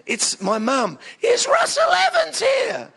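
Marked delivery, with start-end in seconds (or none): 1.2-2.9 very high, loud, drawn-out shriek of a mum; shrill imitation of mum hunting for her son; mum calling out. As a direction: call across a crowded room as an embarrassing mother. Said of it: "I hear this voice. It's my mum".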